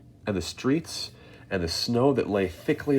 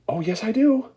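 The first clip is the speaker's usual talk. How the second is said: gleeful answer; 14 semitones higher, 7 dB louder than usual. A narrator's reading. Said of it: "answered Mister Wonka".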